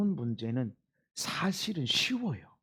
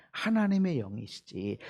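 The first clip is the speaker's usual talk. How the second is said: ordinary talk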